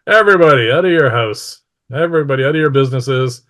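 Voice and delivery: retold scream. A narrator's reading. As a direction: call out to a whole town, short of screaming. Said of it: "he screams at the top of his lungs".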